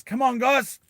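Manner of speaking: wounded Tommy rallying his squad with a yell. Retold or imitated. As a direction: shout them forward. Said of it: "Tommy yells out and he goes".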